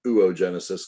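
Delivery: "oogenesis" opening with OO, then a long O; own oo-oh pronunciation. Shown as a demonstration. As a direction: say "oogenesis" as oo-oh-JEN-uh-sis. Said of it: "although I pronounce it".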